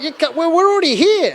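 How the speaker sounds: high-pitched, stammered objection; protesting Palestinians. Acted. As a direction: protest in disbelief, tripping over the words. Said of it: "Palestinians are like".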